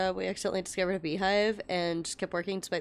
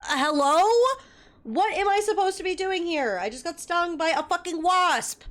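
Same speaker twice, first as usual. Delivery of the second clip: put-on indignant kid; 8 semitones higher, 4 dB louder than usual. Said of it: "I was like".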